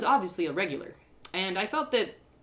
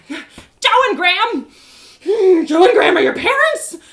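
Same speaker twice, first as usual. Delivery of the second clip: loud, higher, laughing in disbelief; incredulous laughing; 13 semitones higher, 15 dB louder than usual. Acted. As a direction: laugh out the names you can't believe. Learns he's the robbers' son.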